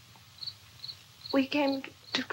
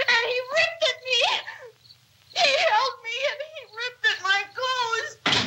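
higher, hurried and frantic; reliving the attack in terror; panicked retelling; 7 semitones higher than usual. Real, not acted.

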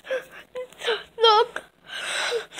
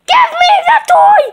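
squabbling doll; 7 semitones higher than usual. Pretend. Two dolls fight over the toy, high crying shout.

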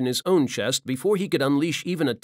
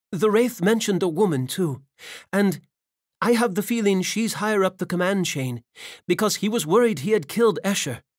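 higher female voice, earnest and urgent; Ava's voice; 7 semitones higher than usual. Acted. Ava telling Valen about the Wraith, urgent and earnest. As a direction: report it like a fighter sharing intel.

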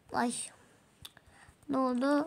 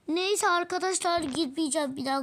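usual speaking voice

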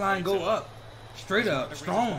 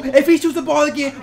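excited shout; 4 semitones higher, 8 dB louder than usual. Real, not acted. Excited reaction to hot shooting.

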